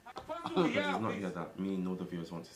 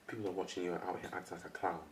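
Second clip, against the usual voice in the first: normal talking voice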